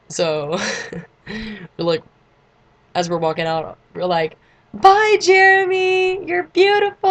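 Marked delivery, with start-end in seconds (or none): 4.7-7.1 high, loud singsong holler; calling out flirtatiously.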